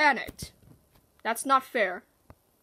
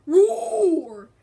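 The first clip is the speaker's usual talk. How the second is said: high, loud play roar; tiger roar; 4 semitones higher, 7 dB louder than usual. Pretend. Player voicing Tiger in a game, loud high roar. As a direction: roar like a pretend tiger on the attack.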